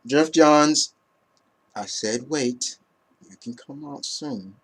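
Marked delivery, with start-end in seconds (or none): 1.8-4.5 quiet offscreen aside; hushed aside. Real, not acted. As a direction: murmur it off to the side. Calling softly to someone offscreen.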